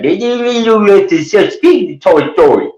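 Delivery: very high, worked-up caricature; hammed-up, over-dedicated rant; Glenn. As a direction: garble it in a high, fervent mock voice. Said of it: "He get dedicated".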